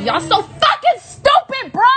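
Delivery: high-pitched, drawn-out exclamation; exasperated outburst. Real, not acted. Streamer scolding meddling viewers, high exasperated outburst. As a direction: let the pitch leap up and stretch it.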